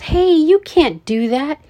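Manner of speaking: high, loud indignant yell. A narrator's reading. Richie beside his fallen friends, yell. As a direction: shout in outraged protest.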